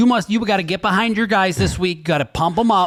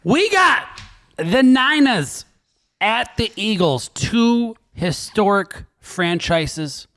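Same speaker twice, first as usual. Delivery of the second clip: matchup announcer; 3 semitones higher, much slower than usual. Pretend. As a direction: stretch out each team like a ring announcer.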